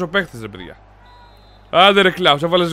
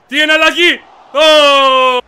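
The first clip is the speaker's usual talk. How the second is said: high cry building louder; amazed shout; 7 semitones higher, 5 dB louder, much slower than usual. Real, not acted. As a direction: exclaim at a brilliant play. Reacting to a great play. (ενθουσιασμένο επιφώνημα)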